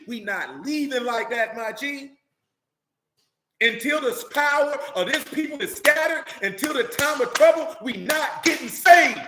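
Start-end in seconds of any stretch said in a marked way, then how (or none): 3.6-9.2 high, loud preaching that peaks in a shout; preaching at full force. Real, not acted. Preacher warning the people they won't be saved, shouting. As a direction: drive it home like a pulpit climax.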